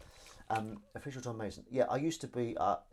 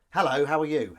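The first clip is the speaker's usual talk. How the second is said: loud, higher demo cue; cue line aloud; 4 semitones higher, 11 dB louder than usual. Shown as a demonstration. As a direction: say the other character's line out clearly. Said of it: "You'd, you'd record".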